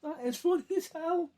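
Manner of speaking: high, laughter-squeezed voice; barely recovering from a laughing fit; laughing hard. Real, not acted. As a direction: squeak it out through real laughter.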